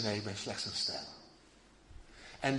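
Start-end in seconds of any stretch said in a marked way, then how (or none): 0.0-1.0 zacht en laag, bescheiden; nederig zacht. Acted. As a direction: laat het wegvallen, nederig. Johannes antwoordt nederig. (zachtjes en nederig als Johannes)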